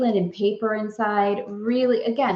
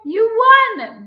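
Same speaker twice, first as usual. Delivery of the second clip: high, loud winner call; excited announcement; 13 semitones higher, 9 dB louder than usual. Real, not acted. Announces the giveaway winner to viewers, high and loud.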